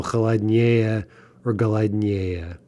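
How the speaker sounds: slow "холоднее" and "голоднее", stressed on the first е of -ее; stress on the ending. Shown as a demonstration. Teacher shows exceptions to the rule, slowly stressing the endings of "холоднее" and "голоднее". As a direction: land on -не́е in "холоднее" and "голоднее". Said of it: "does get in stressed on the".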